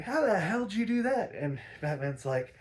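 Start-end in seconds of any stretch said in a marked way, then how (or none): 0.0-1.3 high, loud astonished question; shocked disbelief; amazed Robin. Retold or imitated. Robin to Batman after the acid, high and amazed.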